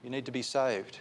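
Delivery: ordinary talk, nothing marked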